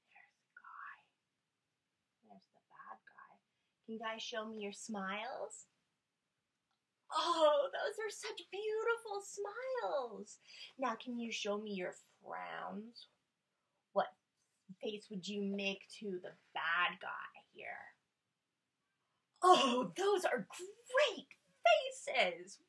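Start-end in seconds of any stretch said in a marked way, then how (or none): 0.1-3.3 soft, secretive whisper about the villain; spooky whisper. Acted. Pointing at villain in picture. 7.2-10.4 loud, high delighted gushing; gushing praise. Real, not acted. Praising children's smiles. 19.4-22.6 loud, high, slow exclaim; mock-shocked delight. Real, not acted. Reacting to frowning faces.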